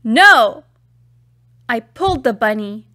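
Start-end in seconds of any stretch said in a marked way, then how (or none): 0.0-0.6 much louder yell; loud childish yell; yelled back. A narrator's reading. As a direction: bark the refusal back. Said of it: "My friend yelled back".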